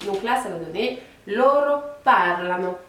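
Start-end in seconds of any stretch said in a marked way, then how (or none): 1.3-2.8 loro parlano slowly, leaning hard on the first syllable of parlano; first-syllable stress. Shown as a demonstration. Teacher showing learners the third person plural, "loro parlano" stressed early. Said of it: "on va accentuer un petit peu plus la première syllabe".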